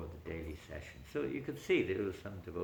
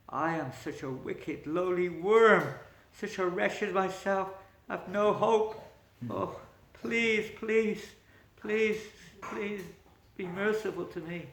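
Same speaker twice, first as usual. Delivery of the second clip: pleading king; 9 semitones higher, 7 dB louder, slower than usual. Acted. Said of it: "The king fell on the ground crying".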